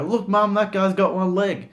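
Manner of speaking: put-on high, loud child's voice blurting it out; a little kid pointing and shouting to mum; excited kid. Kid tells mum about the one-legged man, high and loud.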